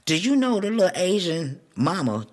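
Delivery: ordinary talk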